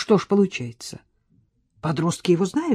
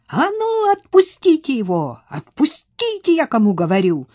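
much higher, ringing shout; wild yell; 8 semitones higher, 4 dB louder than usual. Acted. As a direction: scream at the teens to let go. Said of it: "диким голосом заорала Настя". (дикий крик, высоко)

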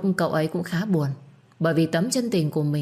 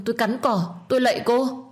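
desperate pleading, higher; 7 semitones higher than usual. Acted. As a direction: voice climbing into a begging cry. Begging for mercy. (van xin tha thiết, giọng cao dần)

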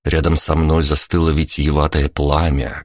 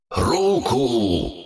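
shout in the mind; 8 semitones higher, 3 dB quieter than usual. A narrator's reading. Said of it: "взорвался в мозгу крик Лазаря".

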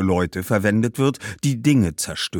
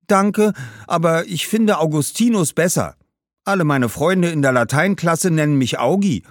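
higher, cheerful schoolboy voice; young boy; 8 semitones higher, 4 dB louder than usual. Acted. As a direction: politely decline in a higher boy's voice. Boy declines the name. (höfliche, höhere Jungenstimme)

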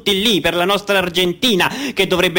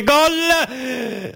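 much higher goal shout; goal call; 10 semitones higher than usual. Acted. Commentator shouting the goal, excited.

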